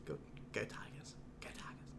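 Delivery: whispered, badly faked Cajun; attempted Cajun accent. Cheering on LSU, failed hushed Cajun accent. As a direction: try a Cajun accent under your breath. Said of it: "I can't do a Cajun accent".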